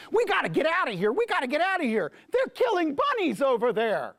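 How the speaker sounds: high, urgent panicked warning; alarmed rabbit. Acted. The scout bunny warns the others after seeing the pelts, in a high panic. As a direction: sound the alarm, we have to flee now. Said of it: "and he said".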